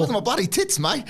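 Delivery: a cartoon British pub lad; high hammy British accent, mate; mock British bloke.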